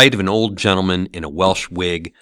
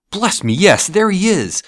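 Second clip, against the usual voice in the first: higher, glad exclamation; light up at seeing an old friend; delighted recognition; 11 semitones higher, 4 dB louder than usual. A narrator's reading. Scrooge pointing out Dick to the ghost, delighted.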